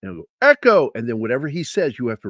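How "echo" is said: high, loud call-out; imitated military summons; drill sergeant's yell. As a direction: holler it down the barracks. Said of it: "he'll yell out".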